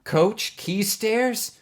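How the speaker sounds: high, slow, breathless disbelief; startled gasp at a ghost from the past; gasping in shock. Acted. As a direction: gasp the name out, stunned. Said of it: "Bug gasped".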